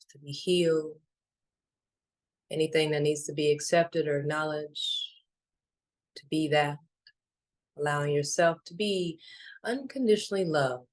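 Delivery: calm, unhurried meditation voice with long pauses; even, spacious delivery, letting each phrase settle; guided meditation. Acted. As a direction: lead listeners gently inward, phrase by phrase.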